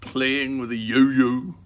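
loud, slow, indignant retort; sharp retort. Acted. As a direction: snap back, stretching the words. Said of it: "Missus Lambchop said sharply".

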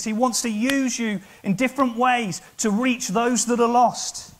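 high, loud, impassioned exhortation; full-force preaching. Real, not acted. Preacher calling the congregation to be thirsty, full-force preaching.

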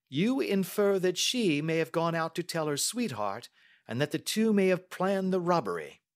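high, brisk questioning detective; Holmes. Acted. Detective questioning the niece about the maid, probing.

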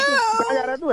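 sudden high, startled question; alarmed. Real, not acted. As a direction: call after him in real surprise. Uncle alarmed caller leaves.

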